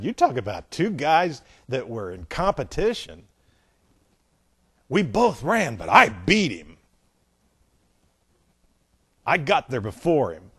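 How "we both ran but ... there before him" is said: competitive boasting. Acted. Acts out competitive disciple's boast.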